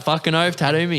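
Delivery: high, laughing mock eagerness; eager agreement. Army boys begging for a tattoo, eager agreement.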